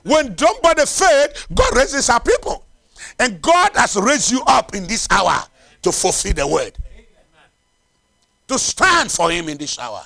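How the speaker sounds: loud, emphatic preacher delivery; forceful preaching. Real, not acted. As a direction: drive each phrase home to the congregation.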